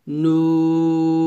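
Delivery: নুন with a long, level rounded "oo"; long-drawn, thin নুন; thin normal pronunciation. Shown as a demonstration. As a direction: round the lips and hold নুন evenly. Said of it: "নরমালভাবে পাতলা করে উচ্চারণ করতে হবে".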